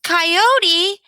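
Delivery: high, loud warning cry; alarmed shout. Acted. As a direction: call out the danger. Sheepdog spots a coyote.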